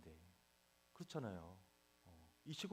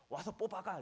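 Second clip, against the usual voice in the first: high, louder mock bark of a grandpa; gruff order; 8 semitones higher, 5 dB louder than usual. Grandfather ordering the pastor to come pull lettuce, loud gruff bark. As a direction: snap the order like a tough old man. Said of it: "이렇게 하시는 거예요".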